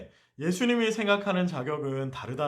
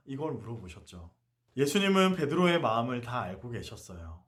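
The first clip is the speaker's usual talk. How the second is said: ordinary delivery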